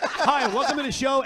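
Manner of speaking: high and loud, laughing; mock host voice. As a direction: play the cheery radio host, laughing. Mocking the host's one question.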